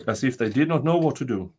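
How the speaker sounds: plain reading voice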